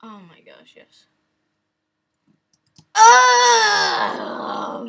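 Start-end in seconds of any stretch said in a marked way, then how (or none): 2.9-4.9 high, loud drawn-out oh; loud exasperated howl; frustrated scream. Real, not acted. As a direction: cry out at the failure. Failing at a game.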